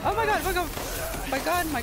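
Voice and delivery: high, panicked cry of oh my god. Real, not acted. Gamer chased by the lady, panicked. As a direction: gasp it out in real panic.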